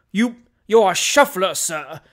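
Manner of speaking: loud, spluttering accusation; furious gasp. Acted. Doctor insulting the evasive stranger, furious. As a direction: sputter with outraged fury. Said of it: "gasped the furious doctor".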